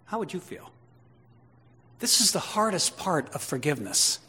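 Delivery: usual speaking voice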